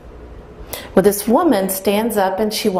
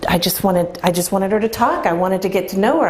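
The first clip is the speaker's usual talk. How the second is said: ordinary talk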